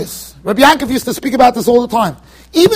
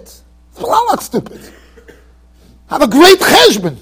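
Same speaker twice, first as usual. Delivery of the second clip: mock protest; 4 semitones higher, slower than usual. Mocking the sinner's excuse.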